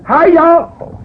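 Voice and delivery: high, piercing Okie holler; Okie accent. Retold or imitated. Imitating the speaker greeting the meeting, high Okie accent. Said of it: "He had that Okie accent".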